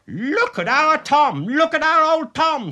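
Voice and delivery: high, loud alarmed scream. A narrator's reading. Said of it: "screamed his wife".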